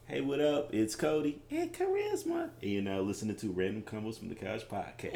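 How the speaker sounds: hushed mimicry of a co-host's intro; imitating co-host's voice. Host pretends to record without co-host, imitating her voice. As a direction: softly put on her voice for the show intro. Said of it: "I just do your voice".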